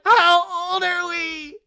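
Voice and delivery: very high, loud, slow mock wail; mock crying. Recapping his own reply in a high mock wail. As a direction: whine it out.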